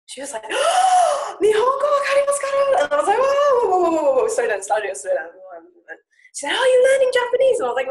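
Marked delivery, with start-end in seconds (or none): 0.5-2.8 much higher, louder, delighted shout; thrilled shout. Retold or imitated. Waitress shouting with delight that she speaks Japanese. 3.2-5.3 flustered whoa. Retold or imitated. Student flustered by the waitress's rapid Japanese, louder. 6.5-7.6 much higher, louder, excited question; eager delight; thrilled shout. Retold or imitated. Waitress excitedly asking if she learns Japanese.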